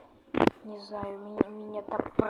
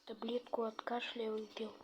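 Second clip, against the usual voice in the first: ordinary delivery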